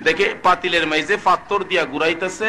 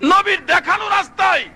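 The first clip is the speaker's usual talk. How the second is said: impassioned sermon call; full-force preaching; 3 dB louder than usual. Real, not acted.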